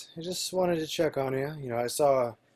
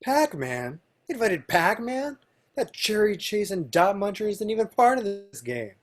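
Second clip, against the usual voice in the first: higher-pitched, incredulous complaint; indignant disbelief; 8 semitones higher than usual. Acted. Ralph left out of the party, indignant at Pac-Man's invite. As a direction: protest it in offended disbelief.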